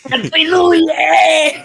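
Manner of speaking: high, loud, drawn-out New Year shout; stretched celebratory scream; jubilant yell. Real, not acted. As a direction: holler it long for the party.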